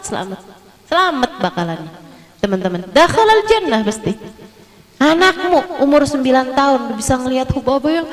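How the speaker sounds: usual speaking voice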